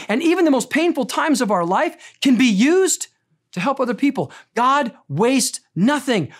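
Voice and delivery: high, ringing preacher's voice, pushing every line; impassioned preaching. Acted. Pastor urging his congregation that pain has purpose, fervent preaching. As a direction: preach it full force to the room.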